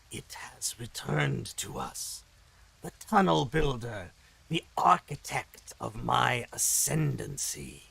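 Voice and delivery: slow, rasping demon lord savoring its triumph; raspy demon voice. Acted. Demon queen gloating to Raymond, slow raspy demon voice. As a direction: rasp it out slowly like an ancient monster. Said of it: "I'm gonna stop doing the raspy demon voice".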